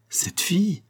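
high, choked-off words; forced out through a tight throat; strangled voice. A narrator's reading. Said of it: "d'une voix étranglée". (voix étranglée, plus aiguë)

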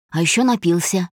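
usual speaking voice

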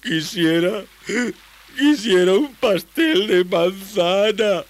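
slow, high, halting wail; hammed-up tearful request; sobbing wish. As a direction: blubber the wish out with a false start. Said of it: "sollozó Tomás".

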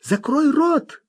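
high urgent shout; sudden shout. A narrator's reading. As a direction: cry out in alarm. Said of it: "крикнула вдруг Лобискви".

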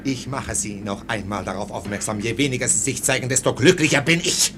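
officer coldly warns his orderly after shouting; low cold warning. Acted. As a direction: drop to a quiet threat.

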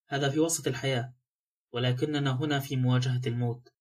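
ordinary delivery

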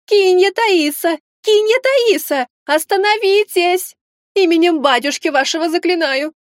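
high, slow breathless yelling; shouting nanny. Acted. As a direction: yell after her while running. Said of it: "кричала женщина".

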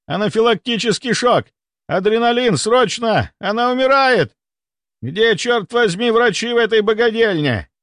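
high-pitched panicked shouting; air-raid alarm yelling. A narrator's reading. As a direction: cry out like an air-raid siren. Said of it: "Крик графа-мультимиллионера как сигнал воздушной тревоги".

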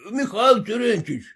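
высокий окрик издалека; позови громко, через толпу; окликая. A narrator's reading. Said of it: "кричали ему мужики". (громкий оклик)

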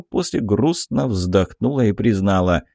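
plain reading voice

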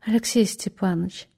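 soft and coaxing; gentle. Acted. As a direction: speak as gently as you can. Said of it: "начал как можно мягче".

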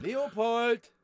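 high, insistent name call. Acted. A voice breaks into Leopold's musing, high call. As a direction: call out to get his attention. Same name called out again.

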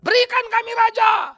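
high, loud crowd demand; full-force high shout. Acted. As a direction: shout it like a people insisting. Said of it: "Israel nuntut raja".

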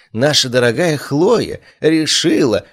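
high, slow, condescending sweetness; patronizing. A narrator's reading. Cassian talks down to dear Chloe, patronizing. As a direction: draw it out with a patronizing smile. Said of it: "с покровительственной улыбкой".

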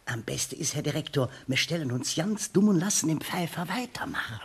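hushed conspiratorial whisper. Acted. Teacher whispers a plan to the director, hushed. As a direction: whisper the plan so the inspector cannot hear.

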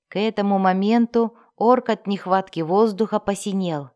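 plain reading voice

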